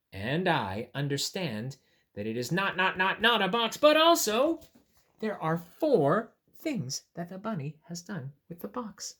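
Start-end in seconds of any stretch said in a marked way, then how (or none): none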